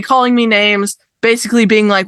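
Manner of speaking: usual speaking voice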